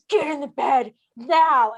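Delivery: loud, drawn-out command; stern retold order. Hurt friend ordering her to bed, slow loud command.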